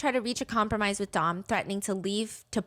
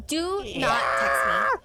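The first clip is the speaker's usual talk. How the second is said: slow, louder, emphatic snap; each word pushed out slowly and forcefully; angry text voice; 2 semitones higher, 5 dB louder, slower than usual. Retold or imitated. Girlfriend's furious text to her boyfriend, angry and emphatic.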